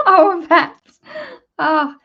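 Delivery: high, loud, through laughter; laughing. Real, not acted. Crafter laughing about her packaging, giggly. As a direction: talk while still laughing.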